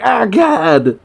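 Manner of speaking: high-pitched acted panic cry; mock freak-out. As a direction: imitate a villain freaking out. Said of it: "freaks out".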